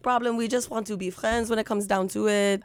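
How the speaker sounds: put-on playful Israeli accent, French-like. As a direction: keep the mock Israeli accent going. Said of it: "France meets the Middle East is how I do it".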